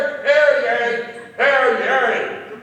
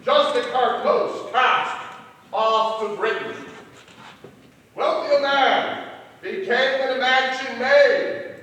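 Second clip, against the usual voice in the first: poem recitation; 10 semitones higher, 9 dB louder than usual. Acted. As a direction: recite it like a ballad.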